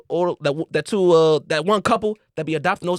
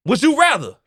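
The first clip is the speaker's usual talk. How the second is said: slow, drawn-out dramatic question; game-show question read; slower than usual. Acted. Podcast host posing a dilemma to friends, slow and dramatic. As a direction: tease the hosts with suspense in each pause. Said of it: "return to Ultimate Ultimatums".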